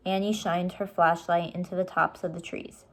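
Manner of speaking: usual speaking voice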